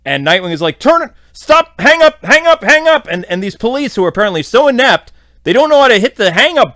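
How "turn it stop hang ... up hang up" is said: high, loud, frantic yelling of orders; urgent shout. Retold or imitated. Nightwing yelling at inept police to hang up, frantic shout. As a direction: yell at the cops to hang up now.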